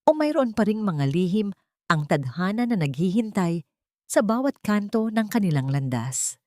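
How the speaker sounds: ordinary delivery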